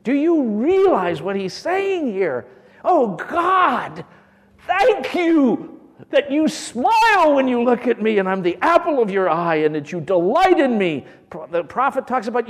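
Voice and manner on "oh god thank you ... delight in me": higher, louder, voicing David's grateful prayer. Preacher voicing David thanking God, louder and higher. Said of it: "Listen to David talking".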